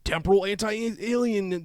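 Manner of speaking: high loud hype reading; hyped list read. Pretend. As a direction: rattle off like a hype man. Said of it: "get ready, this is quite the list".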